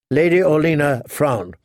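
ordinary delivery

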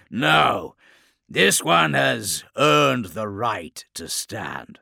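slow, loud, grand pronouncement; commanding warlord. A narrator's reading. Chaos lord granting the captive respect, commanding. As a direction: declare it as a monstrous lord. Lord overrules his snarling underling.

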